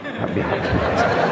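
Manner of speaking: unvoiced, giggling prayer; laughing mock mumble.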